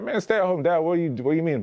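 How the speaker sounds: high, incredulous mock question; friends' incredulous mock reaction to being a stay-at-home dad; mock disbelief. Everyone doubting his dad plan.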